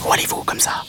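tiny whispered insect voice; breathy whisper mantis. A narrator's reading. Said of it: "demanda l'insecte".

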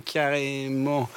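carrément stretched slow and soft; drawn-out carrément. Shown as a demonstration. Showing the hosts the teen habit of stretching carrément, slow. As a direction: drag out carrément lazily. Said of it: "On va faire carrément comme ça".

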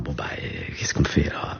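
hushed whisper, barely voiced; whispered question. A boy whispering to his parents, what now. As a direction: whisper it, small and unsure.

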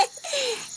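a small amused laugh; soft chuckle. Acted. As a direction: chuckle lightly to yourself. Bat laughs at own joke.

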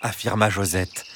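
ordinary delivery, nothing marked

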